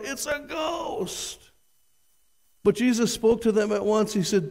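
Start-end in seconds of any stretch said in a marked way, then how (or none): 0.0-1.5 high, thin frightened cry; a terrified disciples' cry; cried out in fear. A narrator's reading. Disciples terrified of a ghost, high frightened cry. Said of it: "they cried out".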